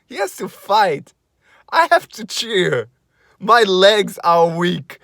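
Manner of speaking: high, loud, breathless excitement; overwhelmed fan. Retold or imitated. Fan at ringside overwhelmed while cheering, high loud excited voice. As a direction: sound shaken and overcome by the moment. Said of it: "I was like".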